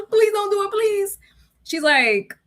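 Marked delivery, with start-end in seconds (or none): 0.1-1.2 mock crying plea. Worker begging her boss again, high mock sobbing. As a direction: beg through mock tears.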